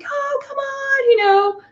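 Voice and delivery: high, slow, gentle coaxing; sweet coaxing. Retold or imitated. Wife sweetly urging the newcomer to shoot with them. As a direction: coax him to stay, sweetly and high. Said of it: "his wife is so sweet".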